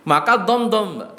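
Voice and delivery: usual speaking voice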